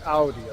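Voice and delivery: "Audi" as ow-dee; German "Audi" with ow at the start; German pronunciation. Shown as a demonstration. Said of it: "That's how the Germans call it".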